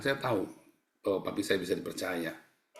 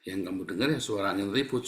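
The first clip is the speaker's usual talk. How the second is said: ordinary delivery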